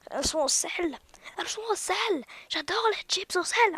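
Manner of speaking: high hammed-up enthusiasm; mock excitement. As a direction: gush over it, overplayed. Mock excited about salted chips.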